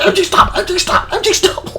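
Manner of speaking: very high, squeaky repeated command; frantic retold pleading. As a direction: plead with an unruly dog, high-pitched. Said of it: "like this".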